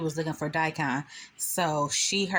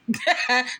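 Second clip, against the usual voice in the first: bright burst of laughter; real laugh; 7 semitones higher, 4 dB louder than usual. Real, not acted. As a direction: crack up at the scene. Laughing at own retelling.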